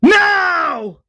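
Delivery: high, anguished held scream. Retold or imitated. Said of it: "the governor screams".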